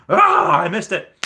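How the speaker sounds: high, loud wail dropping off; mock anguished yell. Streamer reenacting missing out on sauce resale, mock anguished wail. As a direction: cry out in exaggerated frustration. Retelling his outburst at missing out.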